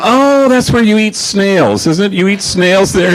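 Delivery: put-on innocent curious kid stretching it out; David. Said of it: "And he goes".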